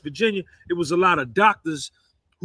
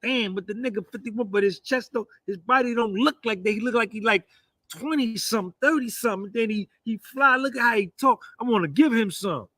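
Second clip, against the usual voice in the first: lightly voiced admiring women, pitched up; admiring honeys; 4 semitones higher than usual. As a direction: play the impressed honeys, a touch higher. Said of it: "and they be like".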